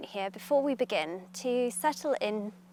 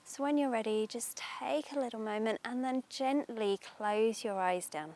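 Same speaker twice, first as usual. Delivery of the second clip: guided meditation; 3 dB louder than usual. Acted. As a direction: ease them into calm, unhurried.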